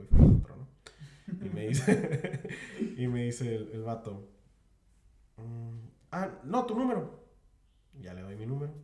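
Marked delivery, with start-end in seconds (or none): 6.1-7.2 high, louder curt demand; mock impatient clerk demanding a name; rude clerk. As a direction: bark the order like a bored clerk. Rude clerk voiced in story.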